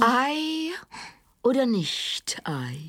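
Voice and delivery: slow, whispered tragic recital; mock-solemn stage whisper; hammed-up Shakespeare. Parodying Hamlet's soliloquy.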